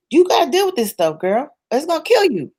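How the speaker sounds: high-pitched, insistent urging; imitating a therapist's urgent pep talk; forceful coaching therapist. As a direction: push her to face it. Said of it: "forcing me to".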